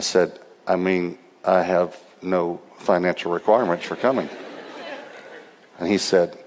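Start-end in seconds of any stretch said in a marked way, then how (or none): 0.7-4.3 low, measured, spaced-out words; careful restatement. Retold or imitated. Preacher repeating himself to a confused pastor, deliberate and low.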